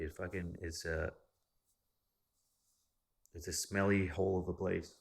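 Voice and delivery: usual speaking voice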